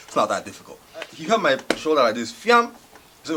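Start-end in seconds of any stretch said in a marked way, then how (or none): 2.3-2.7 high, loud "fiam" sound; imitated grab, high and loud; grab sound effect. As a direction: punch out a sudden snatching noise. Imitates the shoulder being grabbed.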